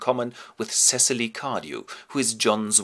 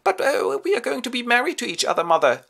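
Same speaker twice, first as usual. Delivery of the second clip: much higher, fast protest; pleading daughter; 14 semitones higher, 4 dB louder than usual. Acted. Gwendolen telling her mother they will marry, higher and hurried. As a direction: hurry to explain to Mummy.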